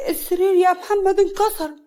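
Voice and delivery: high, loud wail of dismay; acted whining alarm; distressed cry. A narrator's reading. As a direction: cry out that disaster has struck. Bed collapses under the wife.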